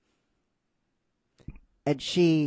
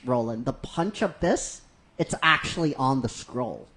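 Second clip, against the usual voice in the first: ordinary delivery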